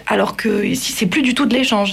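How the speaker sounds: usual speaking voice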